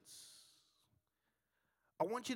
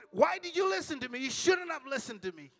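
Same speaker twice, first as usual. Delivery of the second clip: mock exasperated yell; 8 semitones higher, 6 dB louder than usual. Husband mock scolds himself.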